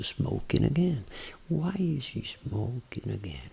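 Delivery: very soft, slow practice; slow hushed words to yourself; intimate voice. Shown as a demonstration. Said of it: "Voz íntima é uma". (voz íntima, lenta e baixinha)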